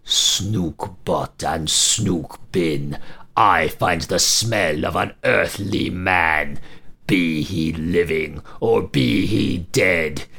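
slow, low giant's chant; monster voice. Acted. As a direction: growl it out like a hungry giant. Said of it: "could be heard crying".

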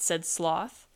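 ordinary delivery, nothing marked

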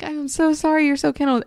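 lightly voiced guilty owner cooing to buddy; sorry dog owner. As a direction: coo an apology to the pup. Apologizing to her kenneled dog.

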